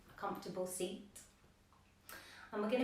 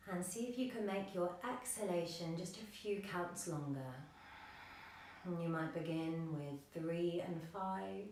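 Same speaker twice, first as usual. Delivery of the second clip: soft, slightly lowered yoga-teacher voice; guided meditation; 3 semitones lower, 6 dB quieter than usual. Acted. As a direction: guide them gently into the breath, with long pauses. Leads breathing meditation.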